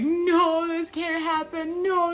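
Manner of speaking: high, loud, sing-song anguished cry; distraught wail. Retold or imitated. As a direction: wail it like your heart breaks. Distraught texting her friend.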